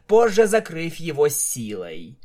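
plain reading voice

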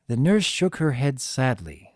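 ordinary delivery, nothing marked

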